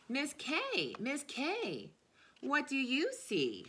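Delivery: put-on slow, drawn-out naming of each child; reading to children. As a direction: call each friend's name like a treat. Reads names of her students.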